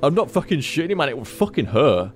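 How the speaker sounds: normal talking voice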